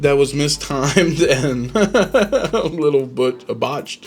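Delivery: amused, laughing through the words; slow down as the laugh breaks in; real chuckle mid-sentence. Real, not acted. Laughs about a botched move.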